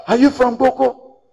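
high, loud disbelieving question; incredulous question. Retold or imitated. People quizzing the brother about the pastor, loud incredulous question. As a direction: ask it as if shocked he doesn't know.

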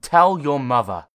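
loud machine bark; barked command. Acted. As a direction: bark the order at the boy. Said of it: "voice from the computer barked".

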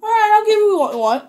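high, loud, hurried giving-in; exasperated surrender, blurted out; mock fed-up shout; Vince. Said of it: "Vince is like".

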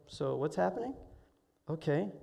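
soft, composed mother on the phone; calm. Retold or imitated. Mother hearing of her son's accident, calm. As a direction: take the news quietly, without alarm. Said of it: "she was so calm".

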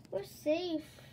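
a restrained, high shout; mild storybook shout. A narrator's reading. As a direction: call out the good news. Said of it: "Rachel shouted as the wind".

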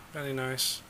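normal talking voice

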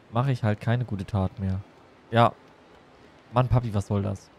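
ordinary talk, nothing marked

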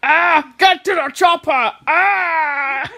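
put-on strained action-hero cry, Austrian accent; Arnold Schwarzenegger. Doing a film star on request, strained Austrian-accented cry. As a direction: bellow it with a thick Austrian accent. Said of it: "Arnold Schwarzenegger do that".

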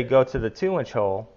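normal talking voice

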